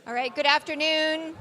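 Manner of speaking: loud, projected teacher voice. Real, not acted. Trying to settle the audience, loud teacher voice. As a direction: carry it over a chattering room. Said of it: "I'll try to use my teacher voice here".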